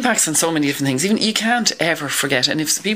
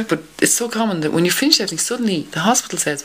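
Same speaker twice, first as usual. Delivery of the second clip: ordinary delivery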